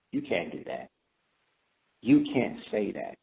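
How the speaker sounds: softly voiced conscience, measured and admonishing; quiet inner voice. Conscience telling us amid that rage, quiet admonishing. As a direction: drop low and soft, like conscience cautioning.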